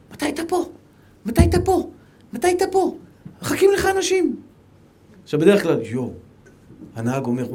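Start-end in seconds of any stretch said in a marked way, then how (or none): 0.1-4.4 high, pestering voice asking when he arrives; impatient caller. Retold or imitated. Wedding hosts phoning the late guest, high and impatient. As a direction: nag him over and over.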